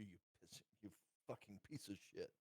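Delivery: ordinary talk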